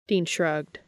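plain reading voice

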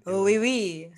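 put-on playful French accent. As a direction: toss it off like a Frenchman. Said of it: "you did that accent".

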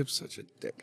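quiet guilty mutter; muttered self-blame. Retold or imitated. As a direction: mumble it to yourself. Imagined self-reproach.

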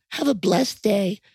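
high, mock voicemail sign-off; put-on high Southern farewell; Southern voicemail greeting. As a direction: imitate a sweet Southern outgoing message. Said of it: "They leave it on their".

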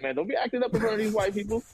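quick, high scolding elder. Retold or imitated. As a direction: warn him like an old uncle.

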